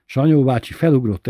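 ordinary delivery

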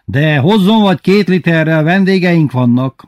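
high, loud shouted reply; calling out. A narrator's reading. Lili asking Tibi bácsi for milk, calling out. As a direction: call out to someone passing in the street. Lili calls to the passing cart.

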